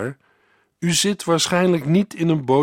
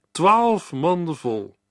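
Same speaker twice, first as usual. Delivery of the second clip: slow emphatic; 4 semitones higher, slower than usual. Shown as a demonstration. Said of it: "verse repeated, slow emphasis".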